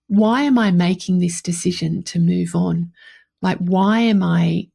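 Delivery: ordinary talk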